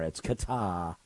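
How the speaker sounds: "Qatar" as kuh-TAH; "Qatar" low and soft, ending in ah; R left off. Shown as a demonstration. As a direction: say "Qatar" with a silent final R. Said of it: "They don't say the R".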